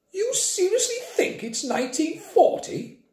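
high, incredulous schoolteacher voice; disbelieving teacher. A narrator's reading. Teacher scoffing at Grandpa, incredulous high voice. Teacher doubting the old man.

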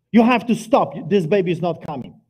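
loud, insistent plea; urgent demand. Retold or imitated. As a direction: press her hard to stop. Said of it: "and then I yell".

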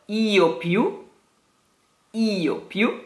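"io più" slow, I of "io" stressed. Shown as a demonstration. Teacher slowly models "io più". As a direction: lean on the I of "io" before "più". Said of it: "stress is on the I".

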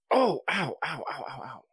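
pained ows after a neck pop; yelping ow, ow from a sudden pop; stretching groan. Real, not acted. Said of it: "I just popped something there".

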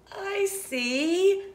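ordinary delivery

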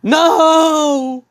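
high, loud, drawn-out scream; anguished high wail of denial; acted scream. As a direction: scream it in horror and hold it long. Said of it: "John screams and said".

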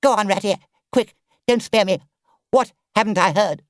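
high, loud, agitated plea; anxious urging. A narrator's reading. Toad begs Rat not to spare him, alarmed.

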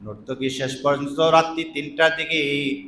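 ordinary delivery

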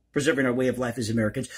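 normal talking voice

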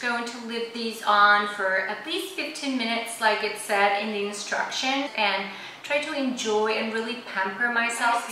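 through a sheet mask; wearing a face mask. Real, not acted. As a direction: lips framed by mask. Seen 1: Gold sheet mask covers face, mouth hole open, throughout all frames.

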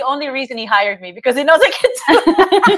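rushed joke breaking into laughter; quick, rising into high laughter; real laughing. Real, not acted. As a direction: crack up as you say it. Jokes about being hired.

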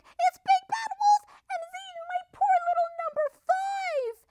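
very high, loud hysterical squawk; crying mother duck. A narrator's reading. Missus Duck accusing the wolf of eating her duckling, shrieking cry. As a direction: wail the accusation at top pitch. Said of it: "she cried".

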